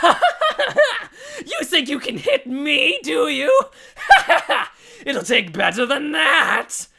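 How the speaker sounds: high, loud, slow manic villain; mad sorcerer. Acted. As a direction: rant like a crazed wizard. Sorcerer mocks missed axe.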